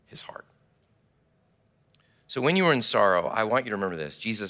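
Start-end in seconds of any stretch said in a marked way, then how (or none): 0.1-0.4 whisper. Real, not acted. Preacher hushes on heartbreak.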